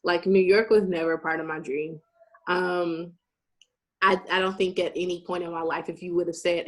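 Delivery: normal talking voice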